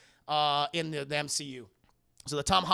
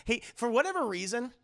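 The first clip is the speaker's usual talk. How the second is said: ordinary talk, nothing marked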